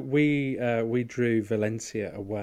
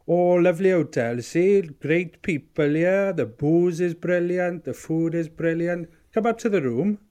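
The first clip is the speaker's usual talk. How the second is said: higher, louder, slow and chummy dad voice; Keitho impression; 7 semitones higher, 7 dB louder than usual. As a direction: play the proud dad showing off his hotel. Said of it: "That's a lovely Keitho impression".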